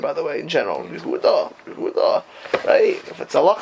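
ordinary delivery

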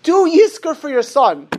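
desperate last-ditch appeal; desperate urging. Retold or imitated. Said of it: "in an act of desperation".

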